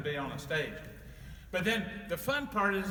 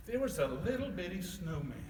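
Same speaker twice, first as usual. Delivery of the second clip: plain reading voice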